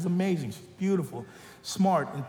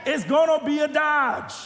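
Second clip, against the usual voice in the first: preaching punchline; 10 semitones higher, 4 dB louder than usual. Real, not acted. Punchline preached at full force.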